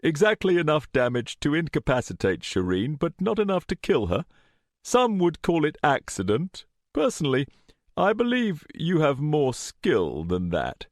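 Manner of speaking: airy, lifted villainous politeness; conversational tone. A narrator's reading. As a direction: lay out the accusation casually. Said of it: "Richard said in a conversational tone".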